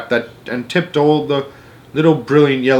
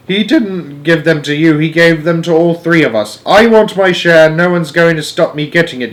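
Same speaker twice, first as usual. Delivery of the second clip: put-on louder, higher angry old man; yelling; 6 semitones higher, 5 dB louder than usual. A narrator's reading. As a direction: bellow it greedily. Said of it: "yelled Grandpa George".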